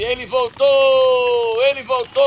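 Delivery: slow, stretched, loud announcer cry; drawn-out announcer shout. Pretend. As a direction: call it out like a ring announcer. Announcing Cid Gomes' return.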